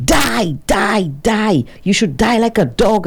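high-pitched mock scream, not full volume; retold screaming. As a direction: imitate her screaming after him.

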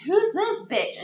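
high, loud outraged shriek; mock furious shriek. Friend retelling the movie, mocking Debbie's jealous shriek. Debbie finds Blue with another woman.

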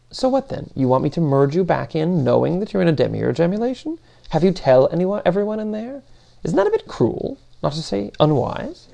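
put-upon, incredulous character voice; exasperated Demiurge. Acted. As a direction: pile up questions, voice climbing at the ends. Demiurge challenges Paquette with questions.

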